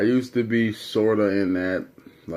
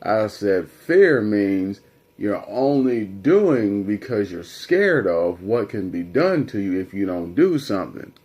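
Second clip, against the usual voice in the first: usual speaking voice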